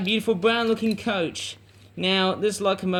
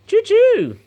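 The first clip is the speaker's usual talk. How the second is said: very high, loud playful train call; gleeful imitated train whistle; train whistle choo-choo; 9 dB louder than usual. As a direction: toot it like a toy whistle.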